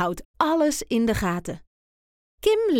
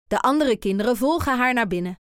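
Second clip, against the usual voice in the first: ordinary delivery, nothing marked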